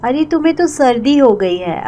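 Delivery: ordinary talk, nothing marked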